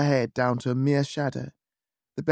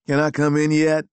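raised call from the hall; calling through door; 6 dB louder than usual. A narrator's reading. Lucy calling from the hall, loud and eager.

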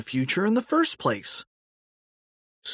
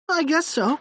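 character voice. A narrator's reading. George answering Harold.

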